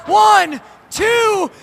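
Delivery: counting the pin out loud; shouted referee count. Real, not acted. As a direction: call the count, excited and high.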